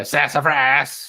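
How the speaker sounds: slow, stretched mock-character voice; cartoon exclamation. Host capping his knucklehead banker act, hammed-up. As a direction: draw the word out in a put-on voice. Tops off the put-on voice.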